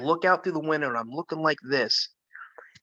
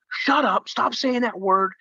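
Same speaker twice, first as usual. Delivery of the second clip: high, louder angry dad; dad snapping; 9 semitones higher, 4 dB louder than usual. Retold or imitated. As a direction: bark it to shut them up. Dad snaps at kids.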